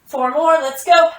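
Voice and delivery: loud, upbeat push to finish; rally the class for the last reps; aerobics instructor. Real, not acted. Pushing class through last reps.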